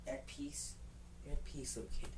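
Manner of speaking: put-on quiet, gentle words to the baby; soft and tender. Narrator softly telling the buried baby it is at peace, tender. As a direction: hush it to the little kid.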